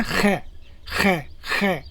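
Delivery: ख as a rasped "kh" from the throat; "kh" sound. Shown as a demonstration. Teacher shows German ch, ख as throaty "kh". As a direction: sound out ख by itself, separately each time. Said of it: "तो इसका sound बोलता है".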